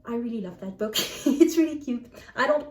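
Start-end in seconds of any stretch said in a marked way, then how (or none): none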